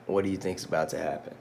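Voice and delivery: ordinary delivery, nothing marked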